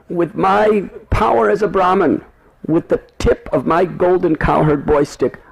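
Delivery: put-on high, proud boyish boast; boastful Brahmin boy. Madhu Mangal boasts to Krishna, high proud voice. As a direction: brag about his Brahmin power in a high voice. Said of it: "He said".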